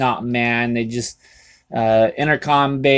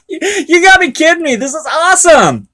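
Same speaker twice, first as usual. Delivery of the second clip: laughing hard; 7 dB louder than usual. Real, not acted. Laughing at the prank.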